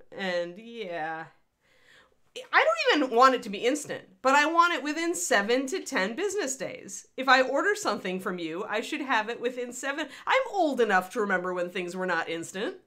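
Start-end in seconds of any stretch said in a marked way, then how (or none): none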